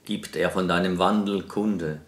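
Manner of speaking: loud, declaimed verse with measured pauses; projected, formal poetry reading; poem recitation. Acted. Recites a poem before the interview.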